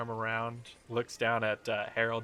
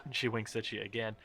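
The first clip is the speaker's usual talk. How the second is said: normal talking voice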